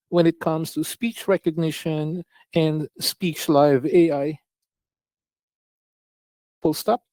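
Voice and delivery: dictating to the app, spoken punctuation; slow dictation with punctuation. Shown as a demonstration. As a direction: read it out like dictating a letter. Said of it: "So to dictate your text".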